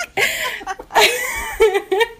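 high, loud burst of laughter; helpless laugh. Real, not acted. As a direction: break down laughing mid-story. Cracks up telling Archie story.

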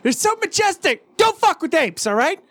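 high, eager tour-guide voice; nature guide. Retold or imitated. Guide warning visitors about apes, gushing. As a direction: gush like a zoo guide, fast at the end. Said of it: "it's like".

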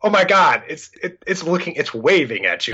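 high-pitched, disbelieving exasperated outburst; mock exasperation. Dad showing daughter an obvious squirrel, exasperated.

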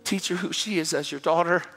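soft, breath-heavy private prayer; pleading prayer. Acted. Husband praying alone for his wife, hushed heartfelt plea. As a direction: plead quietly with God, as if on your knees. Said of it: "gets on its knees and says".

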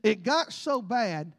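slow, weighty delivery; slow emphasis. Acted. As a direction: let each word land slowly. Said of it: "now hear me".

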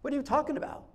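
high-pitched mock bewilderment; baffled reaction. Disciples puzzled by the greeting, baffled.

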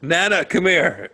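calling a dog. Acted. Roast joke acted: calling dog.

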